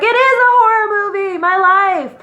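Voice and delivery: high, rushed mock hysteria; frantic exclamation. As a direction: wail it fast and high, playing up the drama. Retold frantic call to boyfriend.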